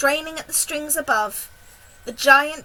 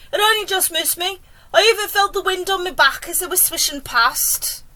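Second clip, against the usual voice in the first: higher, put-on creature wail; wailing earthworm; 5 semitones higher, 4 dB louder than usual. A narrator's reading. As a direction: whine about a narrow escape in a raised voice. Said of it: "wailed the earthworm".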